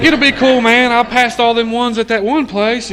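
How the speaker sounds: loud, high-pitched protest; insistent reassurance. Retold or imitated. User reassuring worried junkie friends, loud insistent protest.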